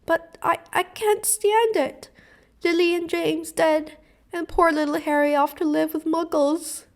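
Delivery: high, loud sobbing wail. Acted. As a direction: cry it out, can barely bear it. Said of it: "sobbed Hagrid".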